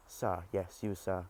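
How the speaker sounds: ordinary talk, nothing marked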